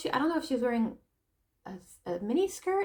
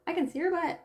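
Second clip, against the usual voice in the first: put-on higher, innocent child's observation; little boy; 7 semitones higher, faster than usual. Four-year-old boy noticing, high innocent child voice. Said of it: "he's like".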